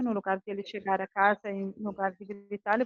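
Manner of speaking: ordinary talk, nothing marked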